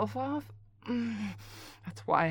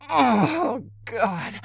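high loud moan; 4 semitones higher, 10 dB louder than usual. Acted. Caller moaning into a partner's voicemail, high loud moan.

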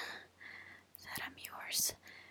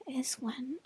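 whispering. Real, not acted. Whispered continuation.

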